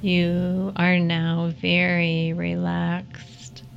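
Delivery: calm them down gently, unhurried. Acted. Leads a guided meditation.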